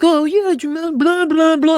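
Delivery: squeaky dismissive blah-blah impression; mock chatbot. Mocking ChatGPT's vanilla reply to host, high and brushed off.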